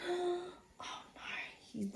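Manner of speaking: gasped, unvoiced whisper. Real, not acted. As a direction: breathe it in alarm. Shocked aside to child.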